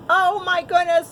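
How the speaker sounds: ordinary delivery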